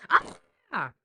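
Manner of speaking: loud tantrum screech, imitated; mock scream. Grandma mimics screaming back at granddaughter, mock scream. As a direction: yell it right back at her. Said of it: "I just did it louder and longer".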